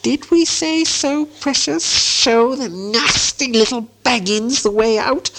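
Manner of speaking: high, slow, hissing creature voice; Gollum. A narrator's reading. Gollum answers Bilbo's demand for the way out, sneering.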